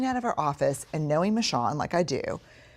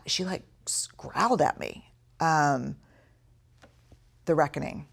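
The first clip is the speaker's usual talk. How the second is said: usual speaking voice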